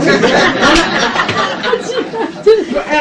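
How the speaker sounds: long high burst of laughter; real delighted laughter; big laugh. Real, not acted. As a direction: laugh out loud at the memory. Laughing at the memory.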